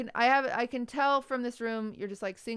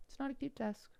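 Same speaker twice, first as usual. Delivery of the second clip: sheepish mock apology; 4 semitones higher, 3 dB quieter, faster than usual. Real, not acted. Apologizing playfully for harsh critique.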